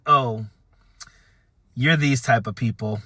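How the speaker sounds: low, deflated "oh", then a pause; retold letdown, pitch dropped; sinking realization. Acted moment of sinking realization.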